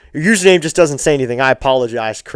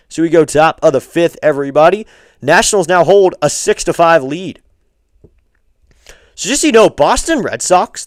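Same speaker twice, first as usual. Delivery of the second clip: louder, higher broadcast lead-in; announcer; 3 semitones higher, 4 dB louder than usual. Acted. Streamer telling viewers the score, announcer voice. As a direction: sell the score to everybody. Announces the next half inning.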